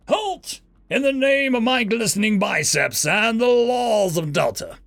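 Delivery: high, booming heroic proclamation; announced grandly. A narrator's reading. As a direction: declare it like a posing hero. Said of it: "the figure announced grandly".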